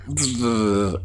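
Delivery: a loud, high buzz like a fly; loud insect buzz. Retold or imitated. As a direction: imitate a fly buzzing around. Fly on the wall.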